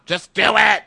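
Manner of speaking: loud, high, pushy shout; hammed-up shouted command; mock yelled order. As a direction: bark it like a bossy roommate. Friend pushes her to kiss.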